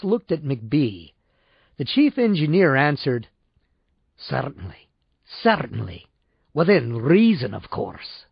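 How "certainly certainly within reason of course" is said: put-on Glasgow accent. A narrator's reading. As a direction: slip deep into a Glasgow accent. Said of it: "He had slipped so far into Glasgow speech".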